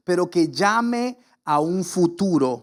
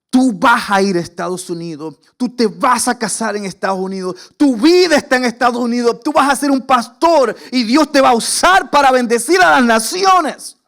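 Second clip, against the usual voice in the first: louder and higher with each line; rising prophetic declaration; 5 semitones higher, 8 dB louder than usual. Retold or imitated. Pastor's daughter declaring his future to young [name], building to a loud cry. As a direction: proclaim it like a prophecy building to a shout. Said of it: "me mira a los ojos y me dice".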